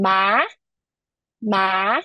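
MA long, rising at the end; second tone. Shown as a demonstration. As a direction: lift MA upward as you hold it. Teacher demonstrating second tone.

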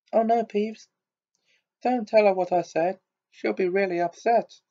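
high, urgent pleading; frantic plea. A narrator's reading. Hermione begging Peeves not to tell, high and frantic. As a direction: beg him to stop, panicking. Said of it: "Hermione whispered frantically".

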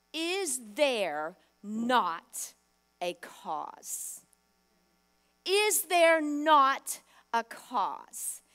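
slow, drawn-out proclamation building to loud; proclaimed. Acted. Preacher proclaiming David's line to the crowd, slow and rising. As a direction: stretch every word, then ring out.

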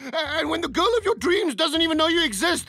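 high, melodramatic guilt; overwrought remorse. Acted. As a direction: pour out mock remorse. Coach spirals into overwrought apology.

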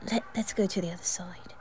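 whisper, urging the listener away; a wary whisper spotting Malfoy; hushed urging. Acted. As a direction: sneak off quietly.

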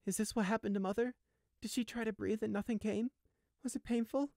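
high, distressed questioning; anguished. A narrator's reading. Sheep imagines his mother's last breaths, anguished. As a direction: ask in a high, grieving voice.

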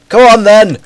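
high, loud goading call; shouted challenge. Real, not acted. Player goads an enemy in game, shouted challenge. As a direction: yell it as a dare.

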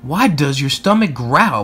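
ordinary delivery, nothing marked

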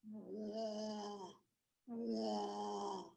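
droning crawfish frog call; imitated frog snore. Shown as a demonstration. Teacher leading the group to say it along, imitated frog snore. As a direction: croak out a long, level snore. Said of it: "he snores, a little bit different snore".

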